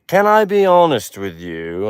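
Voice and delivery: put-on British critic voice; Simon Cowell. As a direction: imitate a British talent-show judge. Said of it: "Simon Cowell's like".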